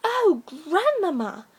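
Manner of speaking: put-on drawn-out childish wonder; wondering little girl. A narrator's reading.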